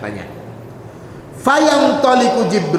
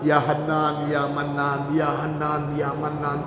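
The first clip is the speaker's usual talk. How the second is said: fading plea; 5 semitones lower, 7 dB quieter than usual. Acted. Repeated plea fading away.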